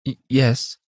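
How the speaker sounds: high, stammered assent; meek reply. A narrator's reading. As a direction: stammer a timid yes. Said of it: "came the meek reply".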